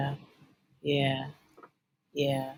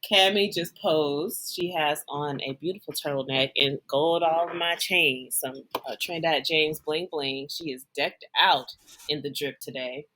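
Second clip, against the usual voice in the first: low, measured play-by-play for listeners; voice commentator; 4 semitones lower than usual. Pretend. Cohost narrating a Rock impression for listeners, low commentator voice. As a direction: describe the pose like a deadpan commentator, low and deliberate. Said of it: "giving the voice commentator for the listeners".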